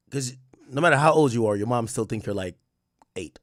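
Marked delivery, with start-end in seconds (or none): none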